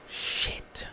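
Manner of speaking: breathy whispered shit under the breath; whispered curse. Real, not acted. Reacting to the mother's sad texts, whispered curse. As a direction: let it slip out in a whisper.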